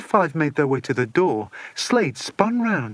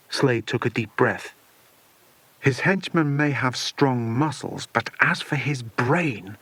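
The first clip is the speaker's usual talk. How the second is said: usual speaking voice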